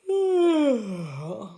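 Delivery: loud stretching yawn; big open yawn while stretching; audible yawn. Shown as a demonstration. Teacher showing the class a loud yawn. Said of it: "make that noise of a yawn".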